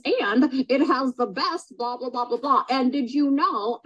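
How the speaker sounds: lightly mocking salesy read-out; mock bullet-point pitch. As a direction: parody a rep reading off a list. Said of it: "not just gonna read off bullet points".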